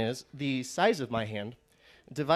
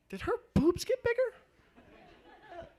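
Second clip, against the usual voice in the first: much higher, slow, dreamy wondering; high squeaky inner voice; much slower than usual. Retold or imitated. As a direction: voice the inner thought high and drawn out.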